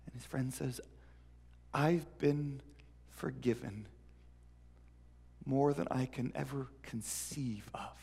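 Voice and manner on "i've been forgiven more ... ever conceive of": quiet sincerity. Acted. Friend explains his forgiveness.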